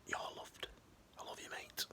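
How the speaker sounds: soft unvoiced whisper in a mate's ear. Whispering love to a struggling mate. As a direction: breathe it right into their ear. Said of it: "whisper in their ear".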